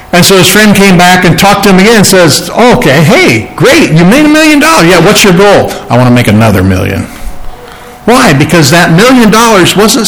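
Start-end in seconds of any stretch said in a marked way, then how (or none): 2.5-5.7 high-pitched congratulation that settles into a question; impressed friend. Retold or imitated. Retold friend congratulates Rockefeller. 5.9-7.1 low, quick reply, lightly voiced; deadpan rich man. Retold Rockefeller answers the friend.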